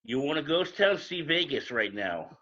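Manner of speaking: ordinary talk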